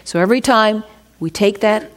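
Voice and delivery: usual speaking voice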